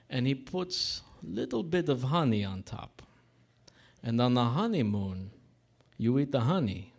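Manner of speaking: thick mock Russian-Italian accent; deliberate mangled Russian English, pausing between phrases. Barber's honeymoon punchline to groom, mock Russian accent. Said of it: "like, a really fucked up accent".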